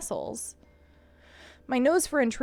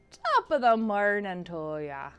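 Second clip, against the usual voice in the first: Irish accent; 3 semitones lower, slower than usual. Shown as a demonstration.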